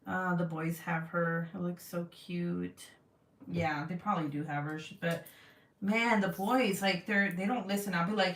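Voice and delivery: ordinary talk, nothing marked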